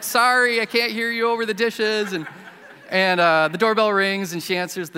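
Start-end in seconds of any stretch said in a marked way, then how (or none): 0.0-2.1 raised, much higher shout over running water; calling over the dishes. Retold or imitated. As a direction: call out loudly from the kitchen sink.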